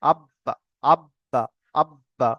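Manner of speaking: আব্বা with the ب held, then released in a short echo; qalqalah bounce. Shown as a demonstration. Teacher showing students qalqalah, আব্বা with a bouncing b. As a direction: close on the b of আব্বা, then let it bounce lightly. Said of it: "ধাক্কা লেগে একটু আলাদা হয়ে যাবে".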